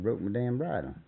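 normal talking voice

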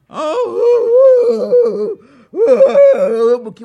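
loud drawn-out sobbing wail; wailing cry. A narrator's reading. Buki crying all night in pain, loud slow wail. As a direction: wail it out like a hurt man.

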